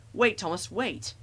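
high mock cry of alarm; screamed plea. A narrator's reading. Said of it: "they screamed".

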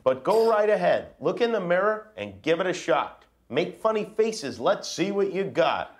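lively, teasing storybook read; reading to children. Acted. Dad reading the book to his kids, playful dare. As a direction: dare the kids to make him laugh.

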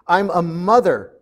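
acted indignant snap, drawn out; indignant declaration. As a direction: declare it firmly, as if insulted. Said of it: "snapped Emily".

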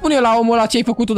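usual speaking voice

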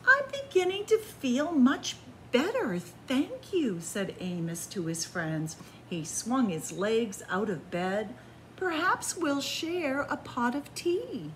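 gentle, lively bedtime storytelling; cozy storybook narration; reading to children. A narrator's reading. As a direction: read it like a picture book to little ones. Reads a picture book aloud.

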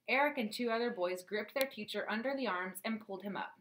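usual speaking voice

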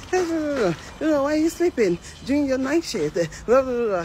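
high, mimicked scolding that runs into blabber; ranting manager. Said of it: "they para, they talk".

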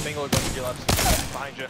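loud warning callout. Real, not acted. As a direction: shout the enemy's position. Warning teammate in fight.

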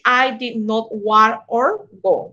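slow model sentence for learners; slow example read. Shown as a demonstration. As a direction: spell it out word by word. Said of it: "or can be".

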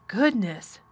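shuddering. A narrator's reading. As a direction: exclaim with a touch of a shudder. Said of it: "shuddered the nurse".

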